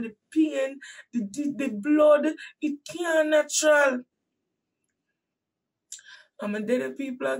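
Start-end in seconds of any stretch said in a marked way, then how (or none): 0.0-4.0 building from firm to a shout; rising retold outcry. Insisting to doctors that something is wrong, building to a shout. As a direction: climb until you are shouting.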